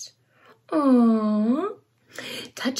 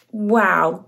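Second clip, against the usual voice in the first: ordinary talk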